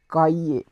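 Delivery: cahier said ka-ye, the h silent; French cahier. Shown as a demonstration. Teacher repeating for students, cahier in French. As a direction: model cahier as ka-ye. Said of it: "we say cahier".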